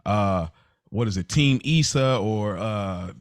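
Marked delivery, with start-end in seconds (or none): none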